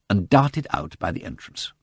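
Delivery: ordinary delivery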